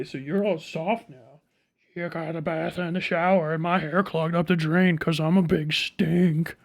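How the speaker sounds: ordinary talk, nothing marked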